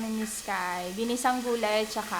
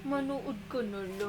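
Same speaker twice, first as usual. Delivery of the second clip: soft hush; 9 dB quieter than usual. Real, not acted. Mother hushing child off camera.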